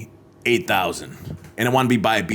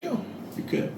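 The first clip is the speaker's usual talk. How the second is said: whispered; 13 dB quieter than usual. Real, not acted. Trailing off, barely audible.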